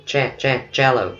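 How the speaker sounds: "cha-, cha-, shallow" with a clear CH onset; CH sound drilled. Shown as a demonstration. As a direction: drill the ch, then say "shallow" as CHEL-oh. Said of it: "Ý nó đọc là".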